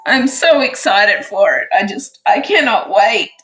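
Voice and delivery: choked up with excitement, near crying; on the verge of crying from joy; teary and emotional. Real, not acted. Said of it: "I'm all emotional and teary".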